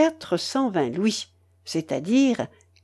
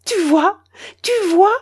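high, eager repeated question; old woman beaming with triumph; lively, beaming; 10 semitones higher, 5 dB louder than usual. A narrator's reading. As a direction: crow with delight. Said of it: "dit vivement la babouchka toute rayonnante".

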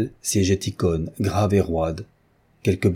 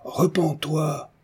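high, slow exhortation; crying out; 8 semitones higher, much slower than usual. A narrator's reading. Lout demands the widow repent, crying out. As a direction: stretch out the high cry. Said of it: "cria un butor".

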